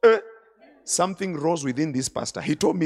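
usual speaking voice

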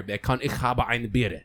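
slow, deliberate German phrase. Shown as a demonstration. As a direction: stretch it out in German with throaty consonants. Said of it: "if you said, like".

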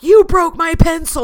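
high, loud hammed-up outburst; mock rage. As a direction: yell it like an overblown tantrum over something tiny. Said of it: "in those moments of rage".

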